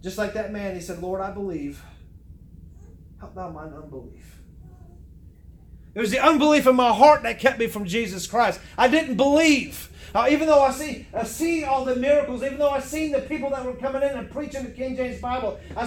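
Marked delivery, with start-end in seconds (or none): 1.0-4.4 low, hushed retold prayer; humbled confession. Retells the man's humbled confession. 5.9-12.8 loud, emphatic testimony from the pulpit; forceful preaching. Real, not acted.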